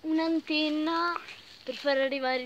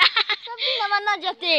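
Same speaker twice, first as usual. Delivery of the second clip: high, raised exasperated cry; exasperated scolding; 8 semitones higher, 5 dB louder than usual. Real, not acted. Scolding a companion after a mishap, high exasperated cry. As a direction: scold someone in a high, loud voice.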